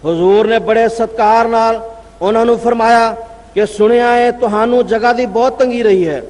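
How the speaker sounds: ordinary delivery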